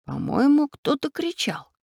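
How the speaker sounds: high, hesitant, slowing down; unsure. A narrator's reading. Man wondering about a distant cry, unsure. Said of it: "неуверенно спросил Семён Семёныч".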